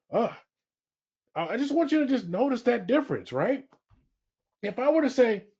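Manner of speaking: ordinary talk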